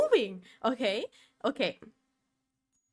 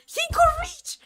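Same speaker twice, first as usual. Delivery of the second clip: loud outraged cry; 7 dB louder than usual. Real, not acted. Repeated exclamation, louder take.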